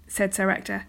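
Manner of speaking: ordinary delivery, nothing marked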